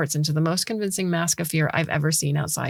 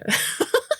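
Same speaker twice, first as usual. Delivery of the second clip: real high giggle breaking out; cracking up for real; breathless high laugh. Real, not acted.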